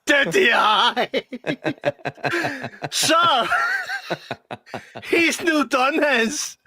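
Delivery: high, squealing quote through giggles; gleeful high-pitched retold tattling; telling on someone through laughter. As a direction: drag it out while cracking up laughing. Retelling schoolboy snitching while cracking up.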